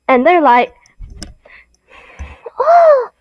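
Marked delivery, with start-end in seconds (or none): 2.6-3.1 shocked gasp. Acted. Children react in shock.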